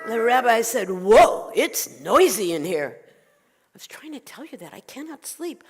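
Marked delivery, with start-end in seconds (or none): 0.9-3.0 loud, drawn-out whoa; startled exclamation. Acted. Rabbi hears all the animals at once, startled. 3.0-5.6 high, whispery and soft; exasperated plea. Acted. Old woman explains her trouble to rabbi, pleading.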